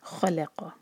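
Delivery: खलक़ whispered, heavy ख and क़; recitation demo. Shown as a demonstration. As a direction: breathe खलक़ with heavy letters. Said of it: "क़ाफ heavy". (खलक़ धीरे)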